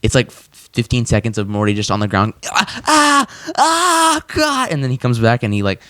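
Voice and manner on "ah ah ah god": pained cry. Retold or imitated. Morty on the ground in pain.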